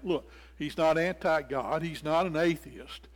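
ordinary delivery

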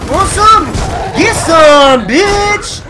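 high, loud shouted taunts; hyped battle taunt. Real, not acted. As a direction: goad the enemy at the top of the voice. Taunting enemies in combat.

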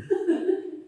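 a short high laugh breaks out; burst of laughter. Real, not acted. Laughing at the poop story, a sudden high laugh. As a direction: laugh at the memory.

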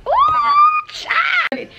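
startled high cry; surprised exclamation. Real, not acted. Sudden exclamation at something seen.

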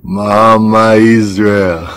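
slow, stretched praise call; drawn-out hail. Acted. Man flattering Mama Israel over food, drawn-out hail. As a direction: stretch each part of the name admiringly. Hailing the food seller admiringly.

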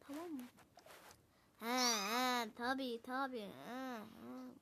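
ordinary talk, nothing marked

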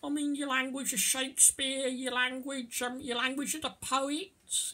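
high, put-on voice gushing over English; mock gushing praise. Teacher mimicking a boaster about the English language, mock gushing. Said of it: "and I'm not gonna go".

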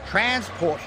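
boastful. A narrator's reading. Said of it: "boasted Nelson".

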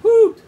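high, loud imitated hoot; sudden high-pitched hoot; hooting like a startled zoo animal. A narrator's reading. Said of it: "woken up the animals".

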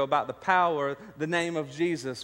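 ordinary delivery

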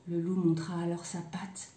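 usual speaking voice

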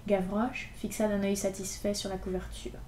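ordinary delivery, nothing marked

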